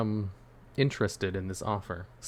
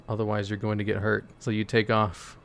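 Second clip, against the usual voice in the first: usual speaking voice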